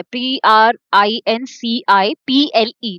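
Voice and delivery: पीआरआईएनसीआईपीएलई spelled letter by letter, slowly; spelling out. Shown as a demonstration. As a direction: spell each letter out for the class. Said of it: "teacher spells the word". (अक्षर-अक्षर धीरे बोलना)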